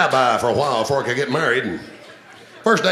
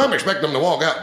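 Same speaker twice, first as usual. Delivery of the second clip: normal talking voice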